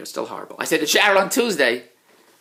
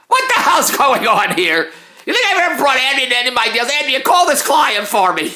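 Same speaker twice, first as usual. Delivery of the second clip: exasperated outburst; 7 semitones higher, 4 dB louder than usual. Real, not acted.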